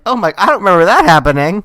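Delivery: high, loud, fast burst of surprise; startled disbelief. Retold or imitated. Podcaster retelling own shock at the face being eaten, startled disbelief.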